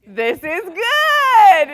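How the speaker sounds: joyful shout. Acted. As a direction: shout the motto with delight. Friend's reply to the apology.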